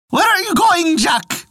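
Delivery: very high crowing rooster voice. Acted. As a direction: squawk it like a rooster. Rooster asks Jack.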